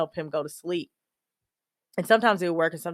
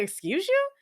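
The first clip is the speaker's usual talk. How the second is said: high-pitched, stunned "excuse you?"; reacting to the sister's news in disbelief; shocked disbelief; 14 semitones higher than usual. To her little sister on the phone, shocked high disbelief.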